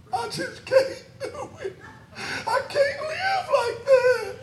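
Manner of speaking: high, soft tearful mock whine; mock whining. Preacher mocking members who find preaching harsh, whiny crying. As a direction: whimper like a wounded churchgoer, hammed up. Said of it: "Somebody whine and cry".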